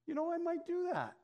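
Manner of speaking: high, lightly voiced woman agreeing tentatively; woman's reply. Agent answers the pastor's church invitation in a light, high voice.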